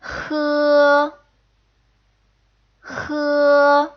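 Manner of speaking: H with the back of the throat narrowed, air rasping over the tongue; H as a rough, throaty breath; throaty Mandarin h. Shown as a demonstration. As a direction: say H close to the ch of loch. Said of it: "It sounds like this".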